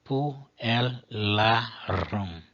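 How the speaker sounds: "Pu, el, la, rum" with clear breaks between syllables; syllables split apart. Shown as a demonstration. Teacher dividing a Latin word syllable by syllable. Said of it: "divididas, uma para cada sílaba".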